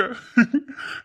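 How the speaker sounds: short rising chuckle. Real, not acted. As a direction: laugh off the teasing. Laughs after mimicking her.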